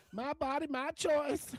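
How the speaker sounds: high falsetto mocking a protester. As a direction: squeak out the slogan in a high, jeering falsetto.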